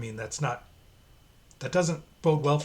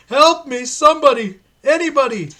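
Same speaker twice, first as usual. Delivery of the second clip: much higher and louder, hammed-up pleading; the dying priest screaming for help; desperate screams for help; 16 dB louder than usual. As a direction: cry out to anyone at all. Priest begging for rescue.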